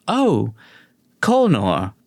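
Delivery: a touch of sheepish confession; lightly voiced perky [name].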